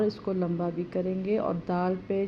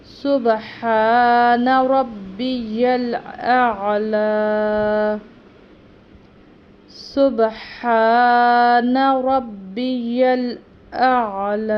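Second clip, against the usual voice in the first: slow, drawn-out chant, much louder; prayer recitation; 3 semitones higher, 10 dB louder, much slower than usual. Shown as a demonstration. Teacher demonstrating sajda tasbih, slow louder chant. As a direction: recite it fully and slowly.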